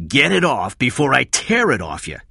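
high, loud threatening bellow; shouting. A narrator's reading. Cy orders hesitant Kathy, shouting. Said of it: "Cy shouted".

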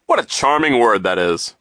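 loud, emphatic, self-satisfied adult voice; booming, swooping reassurance to children; pompous banker figure. A narrator's reading. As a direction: boast loudly about the school.

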